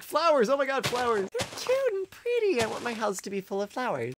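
high-pitched mock gushing over flowers; gushing commenters. Player mimics viewers who love flowers, mock gushing. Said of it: "people in the last video that are like".

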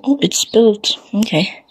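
usual speaking voice

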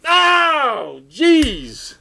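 high, loud "Oh, jeez!" of disgust; shocked outcry. Retold or imitated. Friends seeing the photos, shocked loud outcry. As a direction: recoil from the sight out loud.

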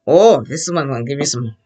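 ordinary talk, nothing marked

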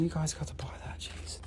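whispered urging to the viewers. Real, not acted. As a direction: lean in and whisper it like a secret. Urging buyers, hushed aside.

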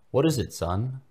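usual speaking voice

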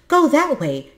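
high, loud excited call; eager little creature shouting directions. A narrator's reading. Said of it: "he shouted loudly with excitement".

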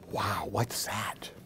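hushed alarm. Acted. Baby Chick hushed and wary in his tent. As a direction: ask it under your breath, scared.

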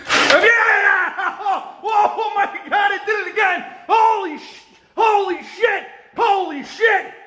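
high panicked yelling; frightened shouting. Real, not acted. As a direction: shout in real terror as it moves again. Thing moved again, freaking out.

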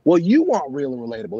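ordinary talk, nothing marked

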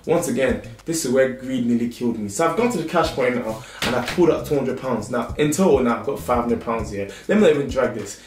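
ordinary talk

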